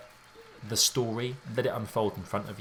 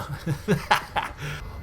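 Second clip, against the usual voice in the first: amused laugh; 5 semitones higher than usual. Real, not acted. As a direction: burst of laughter after a joke scare. Laughs at own mock scream.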